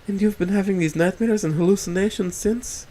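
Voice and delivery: gently worried question; sad sympathy. Acted. Rosa asks about his nightmares, mildly sad and sympathetic. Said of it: "sadness and sympathy plaguing her voice".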